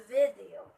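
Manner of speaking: "video" said VID-ee-oh, weight on VID; teacher's model of "video", falling after VID; first-syllable stress. Shown as a demonstration. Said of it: "It should be on the first syllable".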